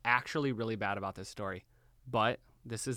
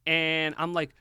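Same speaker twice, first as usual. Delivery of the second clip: normal talking voice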